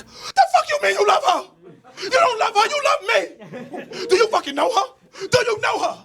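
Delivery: put-on high-pitched screaming girlfriend; imitated hysterical shriek; jealous screaming. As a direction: scream it as a furious, possessive interrogation. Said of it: "starts screaming at me like".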